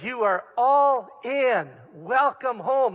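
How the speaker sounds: Jesus welcoming her home, slow and loud; emphatic slow welcome. Acted. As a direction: proclaim it slowly like a gift. Preacher voicing Jesus' welcome.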